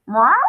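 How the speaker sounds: short cat meow, rising at the end; brief inquiring cat call; questioning meow. A narrator's reading. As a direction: mew like a puzzled cat. Narrator voices Muffin the cat.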